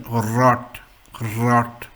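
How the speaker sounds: "rot" with a big rolled r at the start; rolled German R. Shown as a demonstration. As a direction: roll tongue and throat on the r of "rot". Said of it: "it has got a big role".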